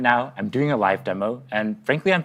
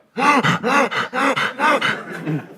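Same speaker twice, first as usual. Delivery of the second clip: rapid deep breath; 12 semitones higher than usual. Real, not acted. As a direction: gulp air too fast. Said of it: "you're not a vacuum cleaner".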